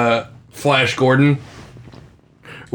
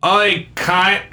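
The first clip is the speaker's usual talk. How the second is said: higher, convincing Australian impression; 5 semitones higher than usual. Shown as a demonstration. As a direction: do a higher, awkward Australian character voice.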